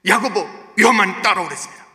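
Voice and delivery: loud, high, impassioned sermon delivery; fervent pulpit voice; full-force preaching. Acted. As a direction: preach it hard to the congregation.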